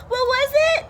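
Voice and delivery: high, loud and eager; urgent call. A narrator's reading. Dog rushing to the tree, calling out loud. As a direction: shout it up the tree.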